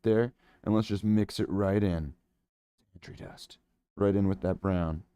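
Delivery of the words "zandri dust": breathy hush of "Zandri dust"; mock mysterious whisper. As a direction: breathe the name secretly. Whispered name.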